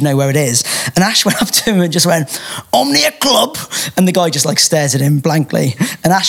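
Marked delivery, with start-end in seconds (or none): 2.7-3.6 put-on high-pitched thick-accent question; Ashley Warbridge's thick accent. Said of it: "Ash has a very thick accent".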